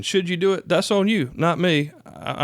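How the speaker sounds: ordinary talk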